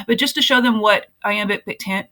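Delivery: ordinary talk, nothing marked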